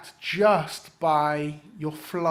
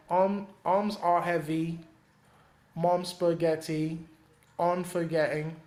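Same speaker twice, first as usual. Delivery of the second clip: slow, stretched stress on arms, heavy, spaghetti; rhymes drawn out; slower than usual. Shown as a demonstration. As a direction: drag out each rhyme slowly. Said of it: "So it's like".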